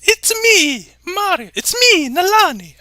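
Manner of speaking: high, loud cartoon Mario. Reading a viewer's chat line as Mario, high and loud. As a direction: shout it like a video game plumber.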